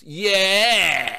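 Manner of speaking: sudden loud yeah; loud cheer. Retold or imitated. Text reader cheers yeah after bad news. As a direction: shout it with joy.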